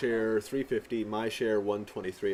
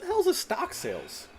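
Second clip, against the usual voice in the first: baffled. Real, not acted. Confused by unfamiliar game term.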